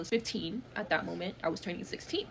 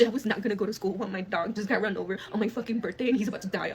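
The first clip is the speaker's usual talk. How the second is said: usual speaking voice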